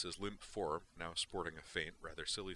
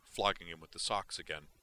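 ordinary delivery

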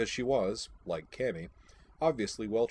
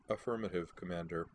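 flat, lifeless, softer monotone reply; 6 dB quieter than usual. Acted. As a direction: answer like an empty soldier, no feeling. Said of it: "her slightly muffled voice flat and lifeless".

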